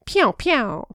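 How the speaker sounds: playful high "pew pew" gunfire; finger-gun shots. A narrator's reading. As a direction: fire imaginary pistols out loud. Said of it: "pointed a pair of finger pistols at him and fired".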